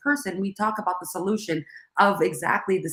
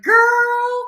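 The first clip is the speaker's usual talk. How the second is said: put-on high, loud drawn-out girl; knowing girl; 9 dB louder than usual. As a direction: sing out girl like a knowing friend. Other moms respond knowingly.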